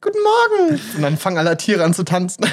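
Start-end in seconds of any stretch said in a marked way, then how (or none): 0.0-0.8 very high, bright princess greeting; Disney princess. Pretend. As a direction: chirp it like a cartoon princess. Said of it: "Disney-Prinzessin".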